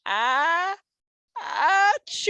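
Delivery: high, building hammed-up sneeze; bear sneeze. Bear tickled by pepper, hammed-up sneeze. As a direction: wind up into a big sneeze. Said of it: "makes the bear go".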